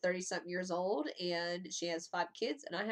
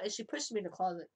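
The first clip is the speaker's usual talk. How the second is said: usual speaking voice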